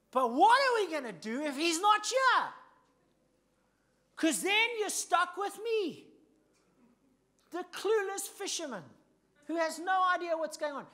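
loud, high, pleading fisherman voice; panicked Peter. Acted. Peter to Jesus, panicking he will be left alone. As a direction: cry out lost without Jesus, stretching the words.

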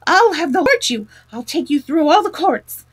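high, loud indignant tirade; furious shouts, stumbling on the insults; outraged shouting. A narrator's reading. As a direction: rant at them, spluttering with rage. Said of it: "simply transported with passion".

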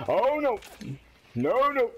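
high, drawn-out cry of dismay; alarmed cry. Real, not acted. Player reacting to a game going wrong, alarmed cry. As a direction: yelp it out in dismay.

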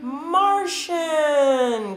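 a high, drawn-out voice from far away; alien answer. Acted. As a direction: answer like a mysterious martian. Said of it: "came the answer".

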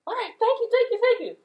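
very high grateful squeak; relieved goat. Acted. Little goat thanking troll, very high relieved goat. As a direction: gasp out thanks like a tiny goat.

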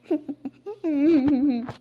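a gloating cackle at her misfortune; mocking laugh. Mother-in-law laughing to mock the daughter-in-law.

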